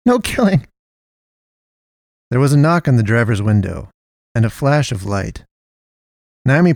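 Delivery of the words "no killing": high shriek. Acted. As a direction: shriek it in alarm. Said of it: "Naomi shrieked".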